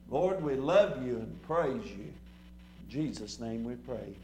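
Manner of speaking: low, slowing prayer that softens to the amen; closing prayer. Real, not acted. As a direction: bring the prayer down to a hushed close. Closes the prayer.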